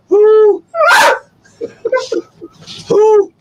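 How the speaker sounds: very high, rising who-is-it calls to the dog; excited dog talk. Real, not acted. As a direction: hype the dogs up, squeaky and eager.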